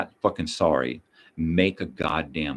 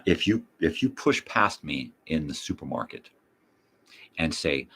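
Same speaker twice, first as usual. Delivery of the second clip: normal talking voice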